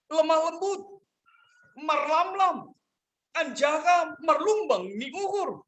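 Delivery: high-pitched sermon exposition; preaching. Acted. Pastor expounding the second point of the verse, preaching.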